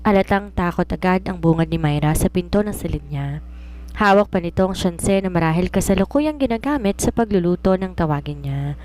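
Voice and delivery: usual speaking voice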